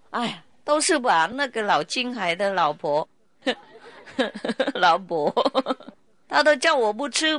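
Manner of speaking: high, mock-plaintive complaint with laughs; lightly hammed-up moaning disciple; whining disciple.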